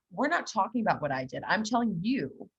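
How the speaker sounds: normal talking voice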